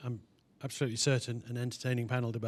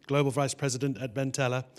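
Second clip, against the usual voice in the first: usual speaking voice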